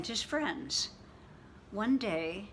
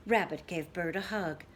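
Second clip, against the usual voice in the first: usual speaking voice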